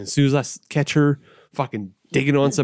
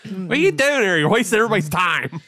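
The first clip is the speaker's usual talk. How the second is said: put-on an exasperated judge in a Pittsburgh accent, higher; 7 semitones higher than usual. Judge scolding the plaintiff for wasting time, in a Pittsburgh accent. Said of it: "the Cajun judge is fucking with a Pittsburgh accent".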